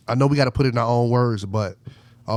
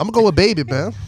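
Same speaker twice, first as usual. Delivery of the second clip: higher, louder hyped announcement; excited; 6 semitones higher, 4 dB louder, faster than usual. Real, not acted. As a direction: burst out with the pick. Drops reporter voice, announces pick.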